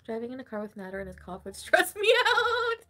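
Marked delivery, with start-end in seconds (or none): 1.5-2.8 louder, breaking into laughter; laughing outburst. Real, not acted. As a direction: crack up while finishing the words. Cracks up over the cough memory.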